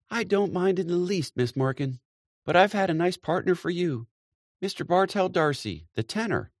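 high, bright, gushing coaxing; coaxing hostess. A narrator's reading. Mary Jane coaxing Miss Daly to dance, high and gushing. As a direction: sweet-talk her into another dance.

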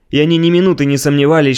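ordinary delivery, nothing marked

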